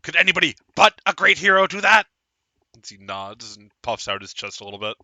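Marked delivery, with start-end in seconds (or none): 0.0-2.1 fast, high bragging; puffed-up halfling bragging; boastful. Acted. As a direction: brag it, pitched up.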